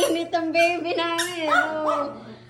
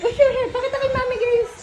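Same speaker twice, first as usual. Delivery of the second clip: excited cheering; 8 semitones higher, 5 dB louder, faster than usual. Real, not acted. Cheering child to show trick.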